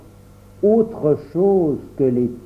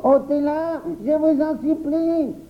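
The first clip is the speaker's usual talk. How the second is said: high, drawn-out panicky whine; terrified wailing; 10 semitones higher, much slower than usual. A narrator's reading. Boy begs others to take the beast away, terrified wail. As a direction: wail and plead as if petrified.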